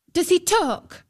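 high, pert question; high-pitched girlish impudence; insolent. A narrator's reading. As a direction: ask cheekily, ignoring the parents. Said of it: "ignoring her parents' discomfort".

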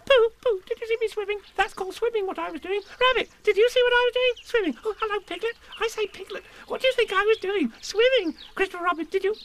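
put-on very high, loud baby squeak; little kangaroo showing off swimming; squeaky Roo. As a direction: squeak it with glee.

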